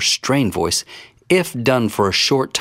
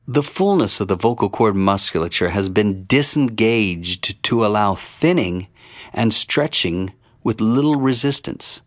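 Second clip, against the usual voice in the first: ordinary delivery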